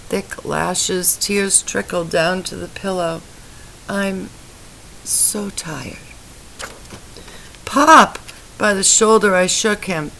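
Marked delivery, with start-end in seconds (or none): 3.9-6.0 much softer, fading to almost nothing; a dying man drifting off; exhausted fade. A narrator's reading. Dying husband fades out. 7.6-8.2 much higher and louder cry; frantic call. A narrator's reading. Wife calls to unresponsive husband.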